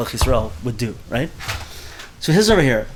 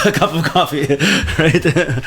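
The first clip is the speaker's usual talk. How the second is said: laughing through the words; chuckling at his own coffee habit; 3 semitones higher, 3 dB louder, slower than usual. Real, not acted. Teacher laughs to his class about his morning coffee, laughing.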